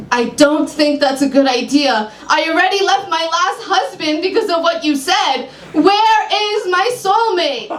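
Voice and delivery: high, rising to a slow demand; frustrated client. Acted. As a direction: insist on your soulmate.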